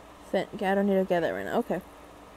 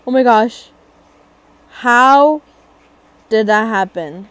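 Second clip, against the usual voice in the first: startled gasp; 10 dB louder than usual. Real, not acted. Gasp then alarm.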